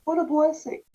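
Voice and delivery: a happy exclaim of relief; thankful cry; delighted exclamation. Retold or imitated. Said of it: "I remember thinking to myself".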